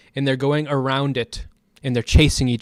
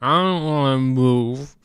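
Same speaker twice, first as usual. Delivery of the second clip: slow, dragged-out mock refusal; heavy, drawn-out impression of stubbornness; stubborn customer; slower than usual. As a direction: dig in sluggishly as a put-on character. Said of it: "He's like".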